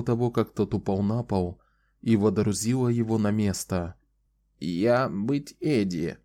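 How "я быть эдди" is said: put-on slow creaky old woman voice. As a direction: creak out each word slowly. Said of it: "ответила она скрипучим голосом".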